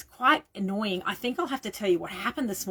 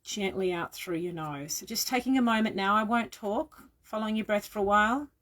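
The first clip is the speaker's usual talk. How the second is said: guided meditation. Real, not acted. Leads guided breathing meditation.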